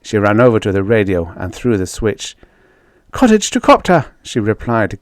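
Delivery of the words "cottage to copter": gasping for breath. Acted. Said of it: "gasping for breath".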